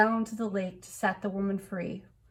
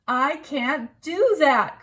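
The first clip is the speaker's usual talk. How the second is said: high, loud, slow protest; crying out; 6 semitones higher, 7 dB louder, slower than usual. A narrator's reading. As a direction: cry out in refusal. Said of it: "cried the king".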